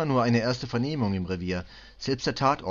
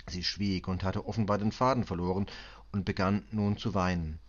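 ordinary delivery, nothing marked

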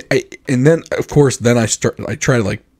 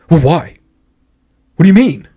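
high, loud, incredulous questioning; mock affronted challenge; 6 semitones higher, 7 dB louder than usual. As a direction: imitate someone instantly triggered by disagreement. Mimics instant reaction to disagreement.

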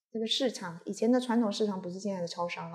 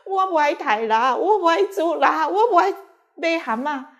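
louder, rising, half-sobbing plea; rising to higher, louder plea like a child in tears; retold crying plea; 7 semitones higher, 8 dB louder than usual. Kneeling before Guanyin in the dream, pleading in tears. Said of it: "这样一直哭".

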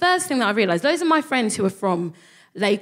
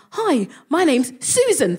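high, slow and overly cheery; nervously chirpy hello to strangers; awkward nervous greeting; 6 semitones higher, 3 dB louder than usual. Retold or imitated. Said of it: "And I went".

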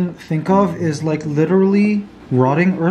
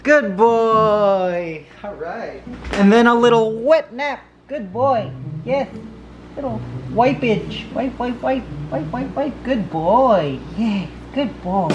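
praising the dog; 4 semitones higher than usual. Real, not acted. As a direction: soothe the puppy with gentle praise. Soothing the dog after medicine.

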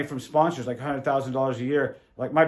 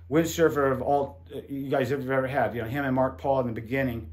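ordinary delivery

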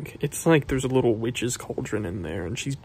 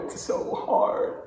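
high, breathless strained squeak; 4 dB quieter, slower than usual. Real, not acted. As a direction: squeak it out in pain. Struggling with hot sauce.

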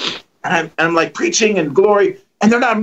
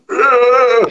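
a mock zombie grunt, a bit louder; zombie groan; 5 semitones higher, 4 dB louder than usual. Zombies jump on stage.

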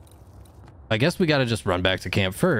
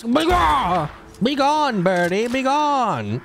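mock banishing cry; 12 semitones higher, much slower than usual. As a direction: banish the bird with theatrical flair. Shooting a bird, banishing it.

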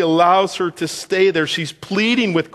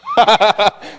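laugh; 6 semitones higher, 6 dB louder than usual. Real, not acted. Laughs at his own joke.

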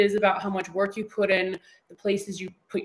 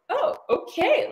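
mock surprise; slower than usual. Reacting to Wembley fight news.

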